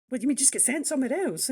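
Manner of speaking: incredulous retort. Retold or imitated. Telling how she questioned the interviewer, incredulous. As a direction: fire it back in disbelief. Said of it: "And I said".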